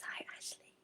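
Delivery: very soft, near-whispered hello; hushed greeting. Real, not acted. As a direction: slip in a hushed aside greeting. Quiet aside greeting a new joiner.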